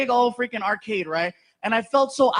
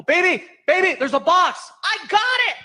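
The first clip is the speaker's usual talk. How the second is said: higher, louder urgent warning call; 9 semitones higher, 5 dB louder than usual. Retold or imitated. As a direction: shout a warning to the driver. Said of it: "and I go".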